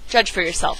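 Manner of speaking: plain reading voice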